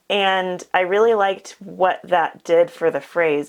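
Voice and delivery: ordinary talk, nothing marked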